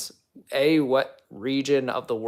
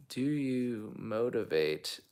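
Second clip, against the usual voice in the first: drawn-out, softer puzzling over it; slow, puzzled repeat; 6 dB quieter, much slower than usual. Real, not acted. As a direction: read it slowly and quietly, unsure of the meaning. I'm not sure what motivate means.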